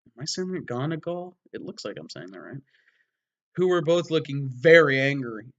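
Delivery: ordinary talk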